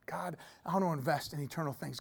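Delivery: soft whisper to God; whispered, prayerful voice. Pretend.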